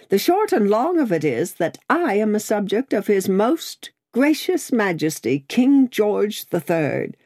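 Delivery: high, proud declaration; Miss Pross, emphatic. A narrator's reading. Miss Pross declares her loyalty.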